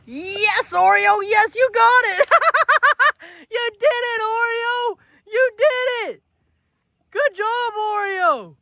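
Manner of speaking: high, loud joyful squeal; jubilant cheer. Real, not acted. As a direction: celebrate the calf's win. Calf finally eats, celebrating.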